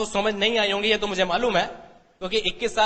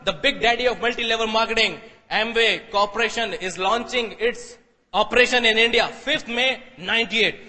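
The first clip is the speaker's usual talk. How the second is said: slow, deliberate headline reading; savouring a remembered newspaper headline; much slower than usual. Shown as a demonstration. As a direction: read the newspaper line slowly word by word. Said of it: "exactly एक word बोल रहा हूं".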